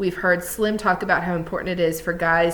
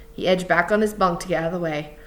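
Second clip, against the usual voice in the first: ordinary delivery, nothing marked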